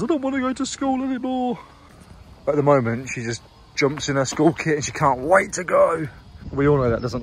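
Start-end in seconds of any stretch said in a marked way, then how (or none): 0.0-1.6 lightly voiced whining little girl, much higher; whiny child voice. Dad imitating his daughter refusing school, high whine. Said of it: "until she says".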